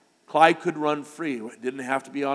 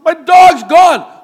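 slow, drawn-out shout, much higher and louder; yelling for the dog; 13 semitones higher, 19 dB louder, much slower than usual. Retold or imitated. Boy on the front porch calls out for his lost dog, full shout. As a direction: bellow it from the porch like a frantic kid. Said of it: "I said".

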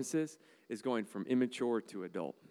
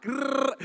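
high loud playful growl; hammed-up higher, louder growl; mock growl; 13 semitones higher, 8 dB louder than usual. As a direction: growl like tearing into a wall. Attacking wall with saw.

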